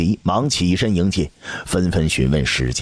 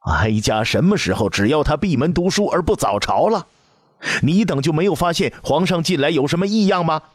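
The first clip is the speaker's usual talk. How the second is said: higher and higher, faster at the end; growing anger; 10 semitones higher than usual. A narrator's reading. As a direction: let the anger build as she asks. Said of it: "太皇太后越说越气".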